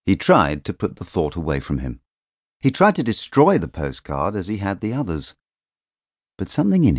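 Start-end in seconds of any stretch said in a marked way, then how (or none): none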